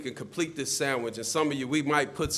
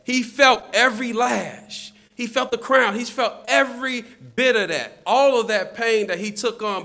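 higher, louder, hammered sermon phrases; emphatic preaching; 7 semitones higher, 6 dB louder than usual. Real, not acted. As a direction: pound out each phrase to the congregation. Preaches the suffering on the cross.

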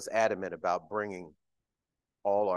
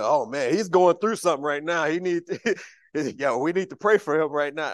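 loud, high imitation of worried churchgoers; mock congregation; 7 semitones higher, 12 dB louder than usual. Preacher imitating congregants fretting over his tears, mock concern. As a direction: play the worried pew, hammed up. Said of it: "some of y'all might be going".

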